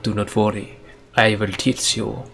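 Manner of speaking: samurai mentor in a badly faked Japanese accent. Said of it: "improve your accent of the Japanese people".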